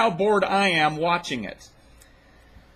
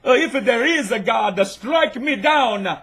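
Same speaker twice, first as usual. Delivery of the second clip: slow mock Italian, higher and louder; Italian accent; 4 semitones higher, 5 dB louder, slower than usual. Retelling the Mussolini quote in a put-on Italian accent. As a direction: drag it out in a hammy Italian voice. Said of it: "let me put on my Italian accent".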